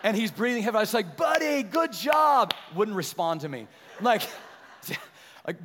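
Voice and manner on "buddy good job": loud, high, drawn-out praise; cheering shout. Retold or imitated. Dad congratulating his winded son off the slide, cheering shout. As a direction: call it out like a proud dad.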